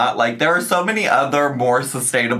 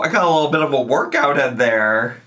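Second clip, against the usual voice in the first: ordinary talk, nothing marked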